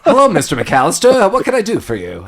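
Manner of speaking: high, loud phone greeting; eager hotel clerk. Retold or imitated. Hotel clerk taking Kevin's reservation call, high eager greeting. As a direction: pipe up brightly like an eager receptionist.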